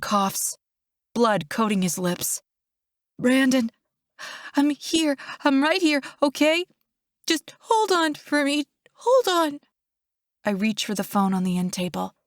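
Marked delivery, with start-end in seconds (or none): 3.2-9.6 higher, anxious pleading; distressed, high-pitched comforting; urgent reassurance. A narrator's reading. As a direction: urge him to stay with you. Urging wounded brother to hold on.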